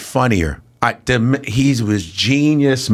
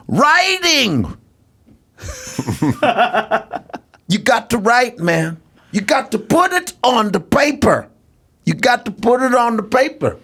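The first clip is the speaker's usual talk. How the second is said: Cosby impression, much higher, laughing; Bill Cosby impression; 10 semitones higher than usual. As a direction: stretch it out like Cosby lecturing. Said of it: "He goes".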